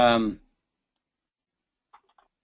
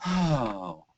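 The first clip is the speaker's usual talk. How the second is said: sigh; 2 semitones lower than usual. Shown as a demonstration. Teacher showing how a sigh differs, sighing. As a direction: sigh it out on an airy breath. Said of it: "is different than a sigh".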